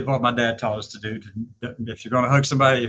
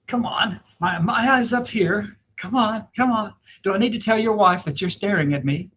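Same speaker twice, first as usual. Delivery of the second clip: high, sassy call-out; mock scolding with a raised pitch; 8 semitones higher than usual. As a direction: tease him for staring, pitched up high. Scolding a staring husband.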